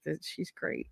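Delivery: whispered, flustered reply; embarrassed whisper. Retold or imitated. Blushing orderly whispering about the patient. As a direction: mumble it shyly under your breath. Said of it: "but he was like".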